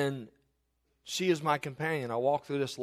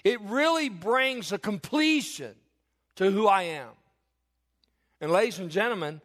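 louder, higher, slowed preaching swell; preaching at full force; 4 semitones higher, 4 dB louder than usual. Acted. Pastor rousing his congregation about God's love, full-force preaching. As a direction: lift the congregation, drawing out each phrase.

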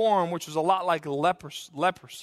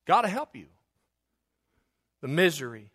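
ordinary talk

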